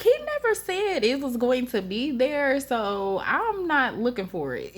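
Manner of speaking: high-pitched, indignant; high excited retort. Retold or imitated. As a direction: squeak it out defensively. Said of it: "I was like".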